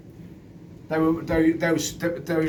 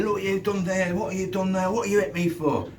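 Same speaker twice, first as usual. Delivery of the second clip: higher, put-on complaining voice; mock whiny lads; 6 semitones higher than usual. Two lads accusing the speaker in front of a passer-by, mock high whine. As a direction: play the wronged victims with a whine. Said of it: "the other two lads were saying".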